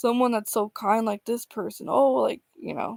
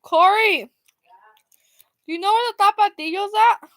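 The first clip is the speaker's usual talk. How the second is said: calling out; 9 semitones higher, 4 dB louder than usual. Real, not acted. Calling out to another room.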